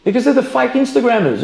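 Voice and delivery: normal talking voice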